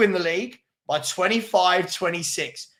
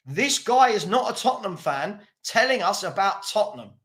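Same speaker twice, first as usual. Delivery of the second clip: ordinary talk, nothing marked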